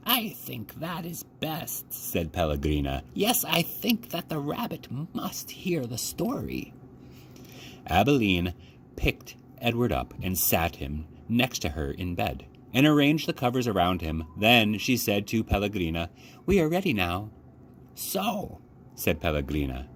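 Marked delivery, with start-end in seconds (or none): 0.1-1.9 put-on soft eerie old woman. A narrator's reading. Grandmother at bedtime. 3.1-6.7 put-on soft eerie old woman. A narrator's reading. Grandmother at bedtime. 16.4-17.3 put-on child voice. A narrator's reading. Child at bedtime. 17.9-18.6 put-on old woman. A narrator's reading. Grandmother starts the tale.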